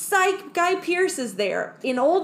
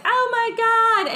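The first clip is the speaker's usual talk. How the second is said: high, loud mock shriek; shocked crew; 12 semitones higher, 6 dB louder than usual. Crew seeing zombie Fifield, high loud mock shriek. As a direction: squeal it in disbelief.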